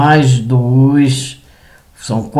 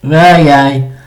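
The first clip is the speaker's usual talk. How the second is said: embarrassed laugh; 2 semitones higher, 3 dB louder than usual. Real, not acted. Laughs after sneezing.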